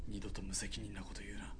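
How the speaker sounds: menacing whisper. Acted. Soldier warns corporal after killing talk. (低くささやくように脅す)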